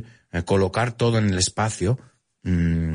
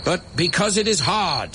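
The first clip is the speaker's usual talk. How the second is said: speech delivery; 10 semitones higher than usual. Retold or imitated. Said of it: "Part of that moonshot speech".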